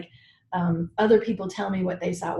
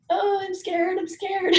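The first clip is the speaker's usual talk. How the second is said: mock scared; 11 semitones higher, slower than usual.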